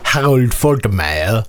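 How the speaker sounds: slow, put-on German accent. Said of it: "That's the best German accent you're gonna get from me".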